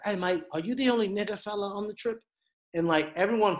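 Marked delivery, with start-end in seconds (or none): 0.0-2.2 higher-pitched mock Australian, casually asking; imitated Australian accent. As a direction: do a matey Australian questioner. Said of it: "one Australian dudes, he was like".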